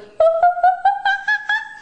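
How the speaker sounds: high hooting monkey noise; monkey call. Pretend. Mom showing the toddler a high hooting monkey call. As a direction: hoot like a monkey. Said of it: "It goes".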